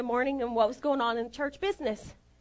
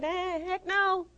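slow, higher-pitched mock refusal; retold refusal; 5 semitones higher, 3 dB quieter, slower than usual. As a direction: drag out the refusal playfully. Retelling her refusal to preach.

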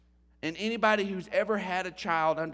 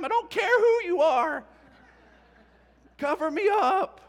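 much higher, louder whiny newborn protest; newborn baby complaining; 8 semitones higher, 6 dB louder than usual. Acted. Dad voicing his just-born baby's complaint, high and loud. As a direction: squeal it like a cold, startled newborn. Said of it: "They're like".

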